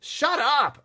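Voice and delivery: loud, high snapped shout at a little brother; angry shout. Acted. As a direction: yell at the pesky brother. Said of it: "I told him".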